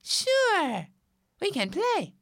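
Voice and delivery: high little ant voice; friendly tiny ant. Acted. Ant offers Rabbit friendship, tiny friendly voice.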